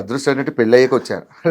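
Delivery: ordinary delivery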